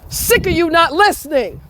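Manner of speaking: real furious high shout of drop it; yelling at the dog. Real, not acted. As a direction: command the dog with full anger.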